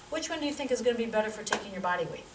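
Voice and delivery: ordinary delivery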